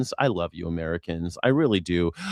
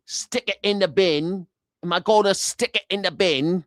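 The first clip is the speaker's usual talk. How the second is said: higher, slowed mock British "stick it in the bin, mate"; mock British accent; 4 semitones higher than usual. Brit correcting an American about bins, mock British accent. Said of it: "We'll be like".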